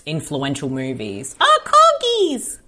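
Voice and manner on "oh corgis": high, slow delighted cry; excited squeal. Real, not acted. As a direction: gush over the corgis. Sees corgis on screen.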